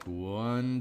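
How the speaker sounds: ordinary delivery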